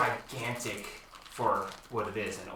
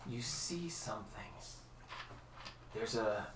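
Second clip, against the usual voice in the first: hushed, softer, voiceless; ominous whisper; 14 dB quieter than usual. A narrator's reading. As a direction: drop to a creepy whisper. Sudden ominous turn in dream.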